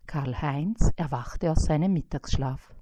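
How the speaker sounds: ordinary delivery, nothing marked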